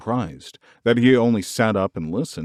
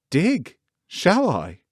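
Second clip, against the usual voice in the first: eager wonder; 8 semitones higher, much slower than usual. A narrator's reading. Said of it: "Colin flushed tremendously".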